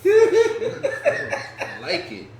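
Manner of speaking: slow laugh-soaked approval, pitched high; laughing through the words. Real, not acted. Host approves the nickname, laughing high.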